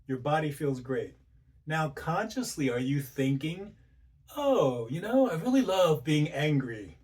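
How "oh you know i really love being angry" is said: mock enthusiasm. Mock inner voice relishing anger.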